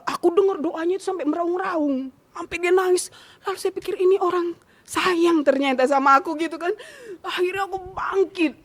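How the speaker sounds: suara tinggi menahan tangis; terharu, hampir menangis. Real, not acted. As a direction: bercerita dengan suara tercekat haru. Terharu mengenang doa suaminya. (terharu menahan tangis)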